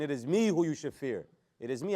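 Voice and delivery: strong punch on the word "me"; emphasis on myself. Shown as a demonstration. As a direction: make "me" the peak of the sentence. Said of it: "I'm putting the emphasis on myself".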